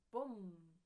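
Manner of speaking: big booming bang; loud cannon boom. Acted. Storyteller voicing the town cannon firing, loud boom. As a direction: make the cannon go off.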